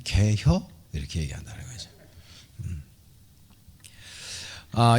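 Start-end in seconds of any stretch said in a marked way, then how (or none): 0.0-0.6 lightly imitated Chungcheong accent, rising question; understated, softer Chungcheong dialect question. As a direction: ask it as a Chungcheong local would. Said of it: "충청도 사람들은".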